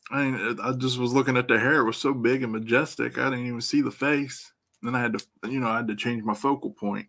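ordinary delivery

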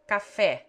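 "Café" with an open, stressed final é; Portuguese example word. Shown as a demonstration. Tutor demonstrates the open Portuguese e. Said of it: "so accented".

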